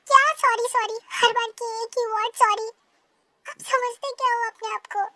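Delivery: loud, high furious outburst. Acted. Girl furious at his repeated sorry, shouting. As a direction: explode at the word sorry.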